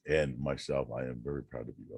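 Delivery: ordinary talk, nothing marked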